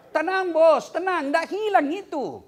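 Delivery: loud, high "tenang, bos" to a panicking pilgrim; calming shout. Retold or imitated. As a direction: call out to settle him down, hammed up.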